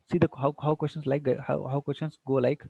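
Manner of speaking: normal talking voice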